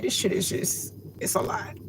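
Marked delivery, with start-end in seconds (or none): 1.2-1.8 barely voiced, tears in the throat; choked whisper. Real, not acted. Overwhelmed while remembering the spiritual awakening, choked whisper. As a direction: whisper it through real tears. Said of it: "It's nothing to be crying about".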